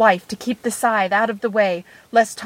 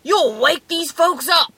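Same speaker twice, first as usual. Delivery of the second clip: raised-pitch wolf warning; annoyed wolf; 6 semitones higher than usual. A narrator's reading. Wolf scolding the little man inside him, annoyed. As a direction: warn the noisy passenger, annoyed.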